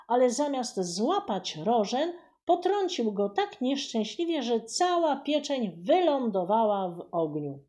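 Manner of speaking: plain reading voice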